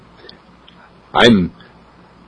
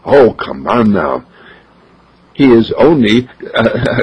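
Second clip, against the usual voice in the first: plain reading voice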